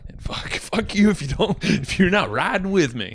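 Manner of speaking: high, loud and laughing, fired up; defiant outburst. Real, not acted.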